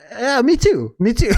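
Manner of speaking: high, eager "me too"; high-pitched mock agreement; imitated listener agreeing. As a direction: squeal along like a fan who relates. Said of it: "other people out there are like".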